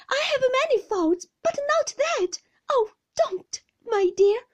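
very high, loud, anguished pleading; crying out in protest. A narrator's reading. Mother denying ingratitude to her husband, crying out high and loud. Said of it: "cried my mother".

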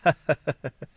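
short, high acted laugh; amused little story-character chuckle; servant woman's laugh. A narrator's reading. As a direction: laugh knowingly before answering. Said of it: "周瑞家的听了道".

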